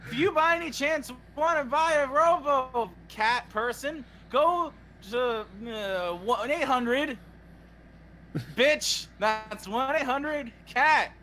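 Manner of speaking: high, slow, stretched announcer voice; hammed-up phone-number plug; fake ad read. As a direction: sell it like a parody infomercial. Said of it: "time for our fake ad of the day".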